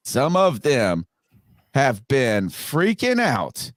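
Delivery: slow, deliberate, broken by pauses; measured repeat, pausing before "have been freaking out"; slow emphatic repeat. Real, not acted. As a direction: draw out each phrase for effect. Repeats the line slowly for effect.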